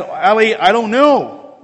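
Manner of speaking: loud, slow drawn-out denial; bemused denial. Retold or imitated. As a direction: shrug it off helplessly. Chaplain deflects loud, slow.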